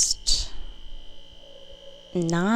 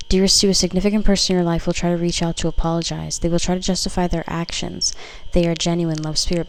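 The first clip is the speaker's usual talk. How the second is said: louder, declaiming the card's words; card message read; 5 dB louder than usual. Acted. Tarot reader reading a card aloud to viewers, louder and declaiming.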